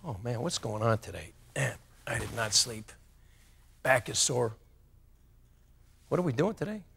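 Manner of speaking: soft, tired mumbling complaint; mock groggy complaint on waking; groggy morning grumble. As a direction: sound half-awake and fed up. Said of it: "how did you start your day today".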